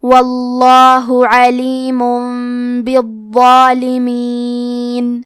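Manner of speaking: slow, melodic tajweed chant of the verse; Quranic recitation. Acted. Teacher reciting the whole verse for students, slow chant.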